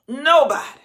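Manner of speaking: loud high shout of nobody; full-force preaching shout. Real, not acted. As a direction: cry it out. Preaching peak.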